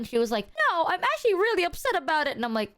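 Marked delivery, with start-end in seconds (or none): 0.7-2.4 high, quick imitation of an upset friend; put-on higher voice, quick and firm; imitated stern upset. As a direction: imitate a friend firmly admitting she's upset. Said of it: "she would talk a bit more stern".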